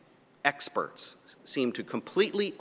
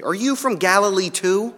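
sarcastic, condescending; 7 semitones higher, 4 dB louder than usual. A narrator's reading. Pharisees reply to Nicodemus, sarcastic and condescending. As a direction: talk down to him with sarcasm. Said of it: "sarcastically again, condescendingly".

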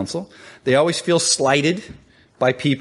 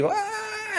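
high-pitched, drawn-out "aah", not loud; mock obnoxious wail; 9 dB quieter than usual. Preacher mimicking a loud, obnoxious person, high mock wail. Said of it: "we have a loud, obnoxious person".

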